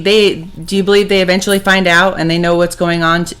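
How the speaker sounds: ordinary talk, nothing marked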